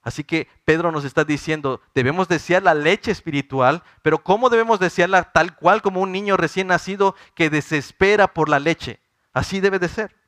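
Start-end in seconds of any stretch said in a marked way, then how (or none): 1.9-10.2 louder, higher preaching with rising urgency; fervent preaching. Acted. Pastor urging the brothers to crave God's word, fervent preaching. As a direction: press the point home like a pulpit climax. Preacher rises to full force.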